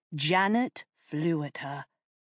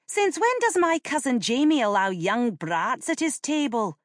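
indignant high demand, somewhat louder; 8 semitones higher, 4 dB louder than usual. A narrator's reading. Lady Jane scorning the child at the Earl's table, indignant demand. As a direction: demand it with haughty outrage. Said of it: "she demanded".

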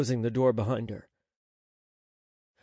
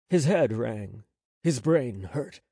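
ordinary delivery, nothing marked

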